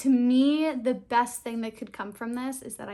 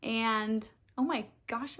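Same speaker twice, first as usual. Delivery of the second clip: ordinary talk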